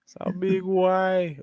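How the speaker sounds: high, drawn-out mimicry; imitating the co-host. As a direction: stretch it out, mimicking your co-host. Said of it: "and you were like".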